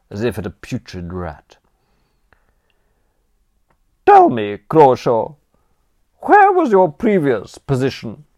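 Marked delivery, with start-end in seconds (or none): 4.0-8.3 high, loud, withering dowager voice; haughty aunt. Acted. Aunt to nephew Bertram, despairing grande dame scorn. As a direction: look down your nose at the nation's ruin.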